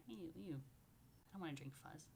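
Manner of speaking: ordinary delivery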